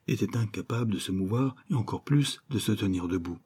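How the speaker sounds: ordinary delivery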